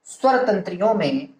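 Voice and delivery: word by word with long pauses; dictation. Real, not acted. Teacher giving students a definition to write down, measured dictation. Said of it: "परिभाषा के रूप में लिख लिया जाए".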